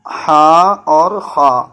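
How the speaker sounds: ہا and خا drawn out slowly from the throat; throaty ha and kha. Shown as a demonstration. Teacher demonstrating throat letters to students. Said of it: "یہ حلق سے نکلتی ہے".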